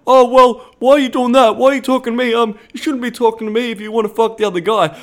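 high, loud mock protest; imitated indignant guy. Voicing most guys' indignant reaction.

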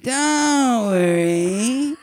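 very drawn-out high, loud wail; creepy boat guy voice. Retold or imitated. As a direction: stretch two words into a long swoop. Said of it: "That voice is too funny".

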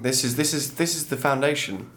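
normal talking voice

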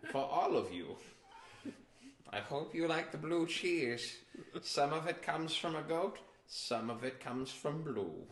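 hammed-up German accent; mock German character voice; dad's German accent. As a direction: put on a comic German dad, stretching some words. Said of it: "my wife doesn't talk like my dad either".

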